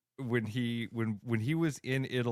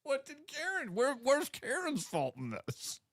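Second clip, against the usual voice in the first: incredulous; 14 semitones higher than usual. Real, not acted. Incredulous protest at co-host's joke.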